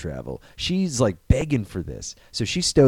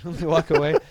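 ordinary delivery

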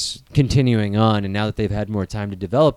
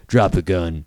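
lower, stern Batman line; 7 semitones lower, slower than usual. Retold or imitated. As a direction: drop low like Batman. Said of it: "He'd be like".

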